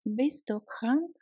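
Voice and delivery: normal talking voice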